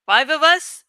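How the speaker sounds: usual speaking voice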